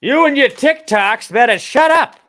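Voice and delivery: put-on high, loud, slow old geezer yelling; cranky old man shouting. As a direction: scold the kids like a grumpy grandpa. Mocking old people scolding kids.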